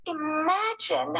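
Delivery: high, delighted exclamation; exclaimed. Acted. Mother marveling at news from space, high exclamation. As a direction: gasp out the word in wonder. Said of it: "Missus Lambchop exclaimed".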